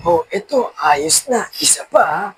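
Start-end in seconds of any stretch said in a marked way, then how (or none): none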